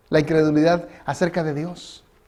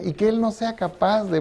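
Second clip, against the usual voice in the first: ordinary talk, nothing marked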